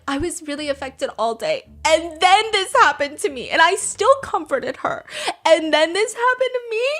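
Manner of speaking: high, whiny fake-teary complaint; mock crying whine. Mocking Zizi whining that this happened to her, fake-teary. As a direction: ham up a sobbing grievance.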